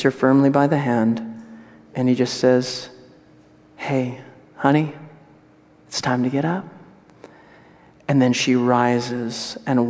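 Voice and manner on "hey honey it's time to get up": put-on softer, tender words to a child; Jesus waking the little girl, softly; gentle wake-up. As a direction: coax her awake gently.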